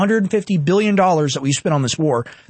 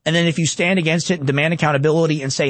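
ordinary talk

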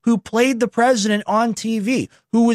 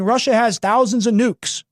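usual speaking voice

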